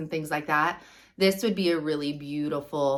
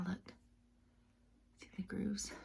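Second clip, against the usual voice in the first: whispering; 16 dB quieter than usual. Real, not acted. Whispered aside while showing item.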